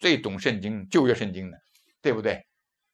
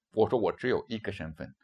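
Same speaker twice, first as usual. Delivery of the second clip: ordinary talk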